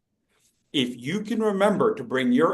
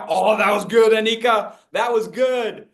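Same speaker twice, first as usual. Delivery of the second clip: excited praise; 6 semitones higher, 4 dB louder than usual. Real, not acted. Praising a student's gesture.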